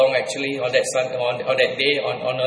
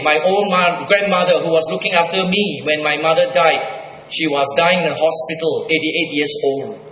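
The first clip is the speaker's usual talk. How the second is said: usual speaking voice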